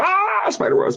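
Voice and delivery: high-pitched imitated shriek of fright; mock scream. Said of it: "And they go".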